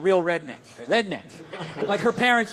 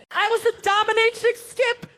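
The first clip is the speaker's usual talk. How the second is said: mock high voice; 14 semitones higher than usual. Joke job list, squeaky punch.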